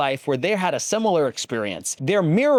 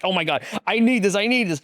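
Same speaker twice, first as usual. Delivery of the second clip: higher, eager gushing; excited; 7 semitones higher, faster than usual. Retold or imitated. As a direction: want it badly, gushing. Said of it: "I was just getting so excited".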